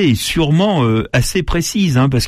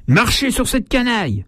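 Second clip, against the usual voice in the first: high, disdainful aristocrat's shout; haughty cry; 11 semitones higher than usual. Retold or imitated. A decorated gentleman orders his coachman over the crowd, haughty. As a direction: sneer down at the rabble. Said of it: "cria au cocher".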